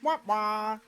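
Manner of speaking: loud "wah, wah" failure sting; mock sad trombone. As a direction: imitate a comic trombone letdown.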